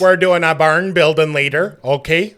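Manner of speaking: lightly acted, loud bossy orders. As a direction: lay down chores to a captive, loudly.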